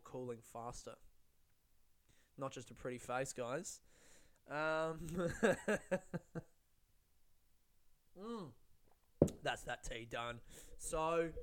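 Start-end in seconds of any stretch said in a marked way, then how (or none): 5.0-6.4 rapid chuckle at your own joke; real run of giggling; amused laugh. Real, not acted. Host after boasting about tea science, amused laugh.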